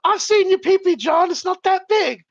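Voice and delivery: a mother's dry put-down; imitating his mom. British mom voiced in story.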